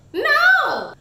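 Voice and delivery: high, loud outraged shout; emphatic no. Real, not acted. Reviewer refusing the love interest, loud high shout. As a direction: shut it down hard.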